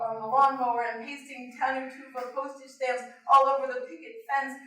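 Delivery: ordinary delivery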